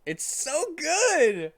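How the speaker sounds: high, drawn-out gush of delight. Real, not acted. Reader gushing to viewers over the fanfic, high and delighted. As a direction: gush over how good it is.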